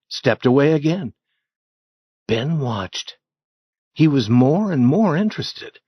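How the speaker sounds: plain reading voice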